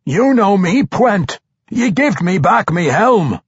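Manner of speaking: high, insistent dwarf holler; urgent, high-pitched appeal; shouting dwarf. A narrator's reading. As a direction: shout at an old comrade to make him listen. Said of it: "came another shout, this time from Brunner".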